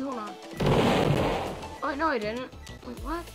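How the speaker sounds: usual speaking voice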